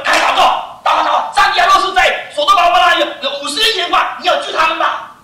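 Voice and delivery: loud, high, rushed begging; urgent pleading prayer. Acted. Abraham begging God to spare Sodom, urgent shout. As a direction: plead fast and loud for the city.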